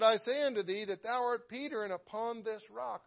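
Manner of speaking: measured, lifted King James reading; scripture reading. Acted. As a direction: read the verses with weight and reverence. Reads scripture aloud in sermon.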